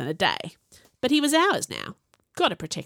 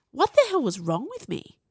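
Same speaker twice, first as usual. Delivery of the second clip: usual speaking voice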